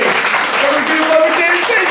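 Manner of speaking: high, loud, fast announcer call; loud hype intro. Real, not acted. Comic hyping the crowd for the host, loud announcer call. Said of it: "remember to keep your fucking bartender".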